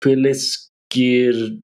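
slow, piece by piece, with long pauses; halting sounding-out. Real, not acted. Learner attempting a long Finnish word, halting sounding-out. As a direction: feel your way through an unknown word, unsure.

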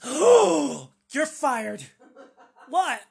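gasp, then a loud high outburst; scandalized boss. Acted. Boss hears midgets and fires the worker in outrage. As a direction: clutch the pearls and fire him on the spot. Skit: offended boss reacts.

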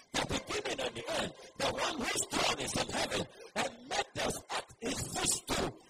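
loud, driving sermon delivery; full-force preaching. Real, not acted. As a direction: build to a shouted call for amen.

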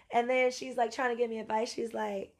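ordinary talk, nothing marked

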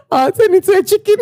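high, squealing laughter in the words; laughing. Real, not acted. Joking to co-host about turning into things, laughing. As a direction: barely get it out for laughing. Cracking up at her own joke.

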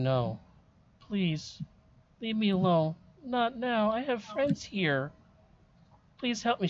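put-on high, scared ghost girl begging; frightened pleading. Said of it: "she starts saying".

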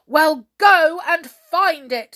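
loud, slow, high command; storming. A narrator's reading. King ordering the brownies away, storming. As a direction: storm it out word by word. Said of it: "stormed the king".

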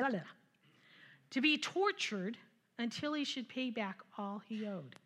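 normal talking voice

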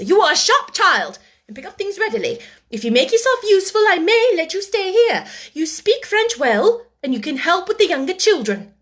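high, loud, brisk authority; imperious instruction. A narrator's reading. Headmistress assigns the orphan teaching work, imperiously. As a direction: dictate terms from above.

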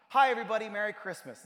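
loud, high burst of hello; bright greeting. Retold or imitated. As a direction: call it out to the whole room. Mother bravely greets hostile in-laws.

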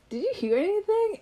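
high-pitched retold question; anxious checking. Anxiously checking whether boyfriend heard.

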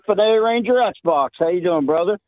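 usual speaking voice

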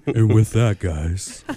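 lightly put-on smooth radio voice. Teasing the host's radio voice, mock late-night DJ.

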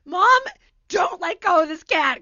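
loud, high order to mom; mock urgent shout. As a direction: yell it back over the commotion. Acts yelling back at mother.

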